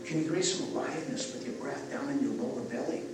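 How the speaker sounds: soft, calm breathwork guidance; guided breathing. Acted. Leads a breathing exercise.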